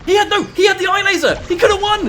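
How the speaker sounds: high, loud disbelieving yell; anguished shout. Real, not acted. Caster yelling at a player's missed win, real anguished shout. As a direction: shout it like the win just slipped away.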